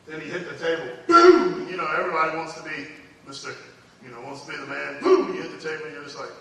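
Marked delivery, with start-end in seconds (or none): 1.1-1.6 shouted boom for a table slam; loud boom. Retold or imitated. Preacher acting the table slam, loud boom. 5.0-5.3 shouted boom for a table slam; loud boom. Retold or imitated. Preacher acting the table slam, loud boom.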